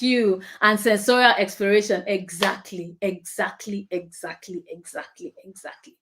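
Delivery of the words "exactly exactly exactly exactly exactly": each exactly softer until whispered; Exactly repeated, dwindling from spoken to breathless whisper; fading to a whisper. Real, not acted. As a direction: let the word die away.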